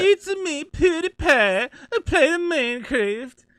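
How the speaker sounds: badly faked Swedish accent, whiny and high; PewDiePie impression. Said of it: "I don't even know how to do his accent".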